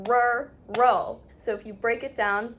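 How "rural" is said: "rural" broken into two beats, "rur" and "ul"; teacher sounding out "rural" syllable by syllable; two separate syllables. Shown as a demonstration. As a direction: split "rural" into rur-ul, firmly. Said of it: "pronounce it as two separate things".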